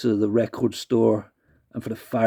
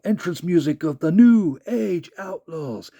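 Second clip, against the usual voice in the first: ring announcer; 6 semitones higher, slower than usual. Retold or imitated. Road Dogg ring intro.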